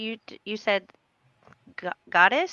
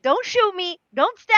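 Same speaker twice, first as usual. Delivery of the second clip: higher, louder playful plea; mock plea; 6 semitones higher, 4 dB louder than usual. Player approaching strangers, not trying to startle them, mock plea. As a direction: plead half-jokingly not to be attacked.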